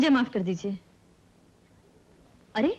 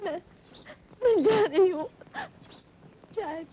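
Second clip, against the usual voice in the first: tearful farewell; 3 semitones higher than usual. Acted. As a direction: bid farewell through tears. Weeping, melodramatic farewell to life.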